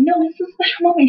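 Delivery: high, hurried mock-emotional dad; gushing dad. Dad clapping at the restaurant, mock-emotional insistence.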